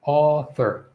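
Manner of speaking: author with a long back AW, no R before TH; careful model of author; open AW vowel. Shown as a demonstration. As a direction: sound out author slowly. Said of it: "It's the aw".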